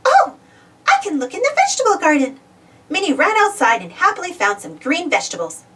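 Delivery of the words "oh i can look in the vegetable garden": bright idea. Acted. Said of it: "she said".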